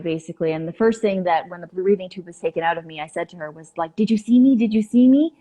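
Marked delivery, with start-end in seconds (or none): 3.9-5.3 higher, louder eager retold question; eagerly pressing for an answer; urgent questioning. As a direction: ask insistently, pitch lifting at the end. Retold urgent question to her sister.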